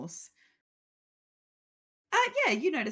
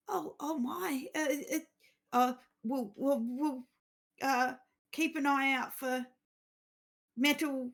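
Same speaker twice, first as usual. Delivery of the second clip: flustered; 7 semitones higher than usual. Acted. Acolyte flustered again.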